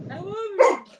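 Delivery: very high, doggy "I love you"; talking dog. Retold or imitated. Owner showing what her dog said, high doggy voice. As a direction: imitate a dog's words way up high. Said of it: "I swear he said".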